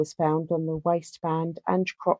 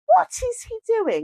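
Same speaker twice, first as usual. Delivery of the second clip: incredulous; 3 dB louder than usual. Real, not acted. Mock outrage at long note.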